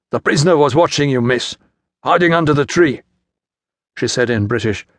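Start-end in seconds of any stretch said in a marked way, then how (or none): 0.1-3.0 gruff, higher-pitched report; a gruff soldier reporting to an officer's wife. A narrator's reading. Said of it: "said gruffly".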